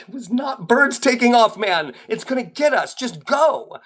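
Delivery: high, frantic arguing; panicked protest. Acted. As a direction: argue back in a panic, pitch jumping up. Acted panicked argument with friend.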